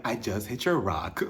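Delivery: slow, low, soft confession; sheepish Lisa impression. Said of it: "Lisa's like".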